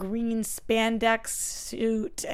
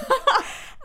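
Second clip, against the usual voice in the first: breathy high laugh; 3 dB louder than usual. Real, not acted. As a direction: burst out laughing. Laughing at own story.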